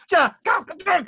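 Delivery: very high, loud rapid yelps; quick death cries. Shown as a demonstration. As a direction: fire the cries off fast. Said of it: "but a lot quicker".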